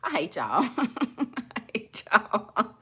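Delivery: helpless laughter through the words; laughing. Real, not acted. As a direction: barely get it out for laughing. Laughing at the viewers' comments.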